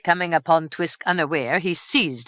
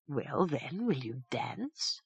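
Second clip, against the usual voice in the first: much softer, slow coaxing; soft teasing invitation; 2 semitones lower, 10 dB quieter, slower than usual. A narrator's reading. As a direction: tempt her lightly. Twisk tempts daughter.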